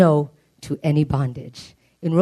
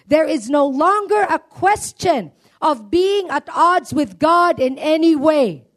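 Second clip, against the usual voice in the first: higher, slow, fervent reading; preached scripture reading; 9 semitones higher, slower than usual. Acted. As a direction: lift the verse up slowly like a proclamation. Reads scripture with fervour.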